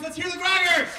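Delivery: high, loud rallying cry; excited call. Real, not acted. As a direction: whip the crowd into noise. Calls for noise.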